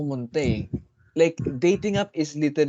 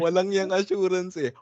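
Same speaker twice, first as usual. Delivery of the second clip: quoting a friend; 5 semitones higher than usual. Retold or imitated. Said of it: "Naalala ko bigla sa pagkasabi mo".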